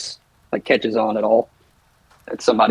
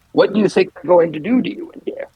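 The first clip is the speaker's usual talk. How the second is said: higher, odd put-on voice; ominous question; 8 semitones higher than usual. Mort taunting the jailed thief, sly and ominous. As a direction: needle him with a sly threat. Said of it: "Mort's gonna say".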